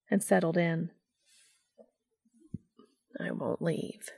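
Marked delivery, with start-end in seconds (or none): 3.2-4.1 hushed promise to a friend; murmured. A narrator's reading. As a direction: murmur it gently. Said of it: "Cass murmured".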